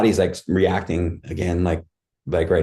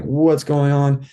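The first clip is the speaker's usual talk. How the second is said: higher, drawn-out alarmed question; a little scared; 6 semitones higher, much slower than usual. Retold or imitated. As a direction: stretch it out in rising worry, retold. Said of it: "start to get a little bit scared".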